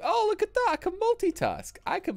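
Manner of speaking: high-pitched mock boast; falsetto quip. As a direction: squeak it out proudly. Jokey self-praise.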